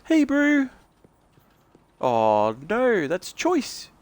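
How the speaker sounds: high, relaxed New Zealand Maori voice; Maori accent. Shown as a demonstration. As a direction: Maori accent, like a laid-back bro. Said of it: "it's more of your, like".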